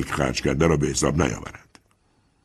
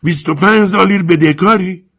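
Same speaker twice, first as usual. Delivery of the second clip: high, loud shocked yell; shouting in disbelief; 7 dB louder than usual. Acted. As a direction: bellow the question at the debtor. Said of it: "رو به سارو فریاد زد".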